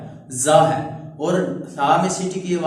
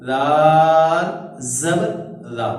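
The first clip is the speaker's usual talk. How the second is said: slow, chanted syllable drill; spelling drill; 5 dB louder, much slower than usual. Shown as a demonstration. Teacher chanting harakat drills for students, slow sing-song. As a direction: sing out each letter and vowel slowly.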